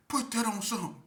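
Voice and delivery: high loud demand. Retold or imitated. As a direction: push him to swear on it. Demanding he swear.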